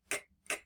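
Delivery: k, k, k as bare breathy clicks with no voice; demonstrating voiceless k; unvoiced k. Shown as a demonstration. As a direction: whisper the k, k, k sound. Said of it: "which means it's unvoiced".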